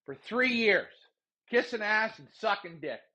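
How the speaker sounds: ordinary talk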